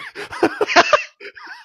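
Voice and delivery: very high shrieking laugh; squealing laugh. Real, not acted. Laughs at whip crack.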